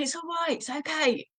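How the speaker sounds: high, soft, worried coo; anxious reassurance. Shown as a demonstration. Owner showing the wrong way to soothe, high and anxious. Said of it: "if I kind of go from this to".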